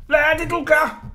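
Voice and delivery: high, loud alarmed yell; panicked scream. A narrator's reading. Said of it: "skreg han".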